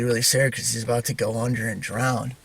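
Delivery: ordinary delivery, nothing marked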